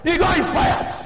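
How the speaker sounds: ordinary talk, nothing marked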